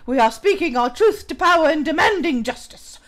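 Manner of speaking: put-on English accent, reading aloud. Streamer reading an article on patriarchy aloud, English accent. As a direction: read it out in a mock English accent. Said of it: "when I'm in my English accent".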